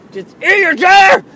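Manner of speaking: very high, very loud yell; furious shout. Retold or imitated. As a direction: scream it, totally fed up. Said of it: "in my head, I'm just like".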